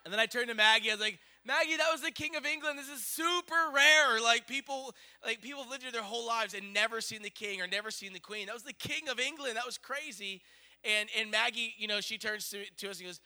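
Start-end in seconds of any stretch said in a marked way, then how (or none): 1.5-10.4 high, insistent gushing; excited. Retold or imitated. Parent turns to daughter after royal sighting, excited gushing. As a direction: press home how amazing it is, high-pitched.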